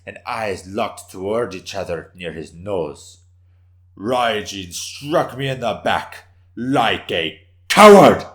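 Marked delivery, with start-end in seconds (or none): 4.0-8.2 loud, slow, bitter accusation; a wounded warrior denouncing his attacker, loud and bitter; spat out. Acted. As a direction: spit out like a coward with scorn. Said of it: "spit out the last three words".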